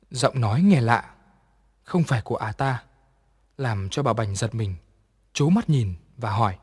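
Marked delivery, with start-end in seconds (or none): none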